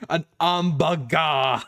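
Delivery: put-on slow French-accented An hamburger; mock French An hamburger. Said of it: "And they're like "A what?".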